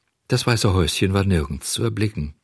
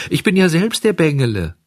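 high-pitched, eager outburst; excited exclamation; 8 semitones higher, 4 dB louder than usual. Acted. Puppet revealing himself to the pigeon, excited. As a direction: burst out with the revelation. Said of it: "rief der Hampelmann und sprang in die Höhe".

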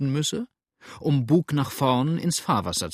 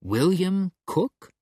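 higher, drawn-out slow incredulous question; stunned disbelief; 7 semitones higher, much slower than usual. A narrator's reading. Yankee cannot believe William Cook was arrested, slow stunned question. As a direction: stretch the name out in disbelief.

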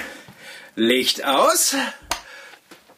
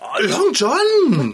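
exasperated; 11 semitones higher, 3 dB louder, much slower than usual. Acted. Owner scolds cat over broken bauble.

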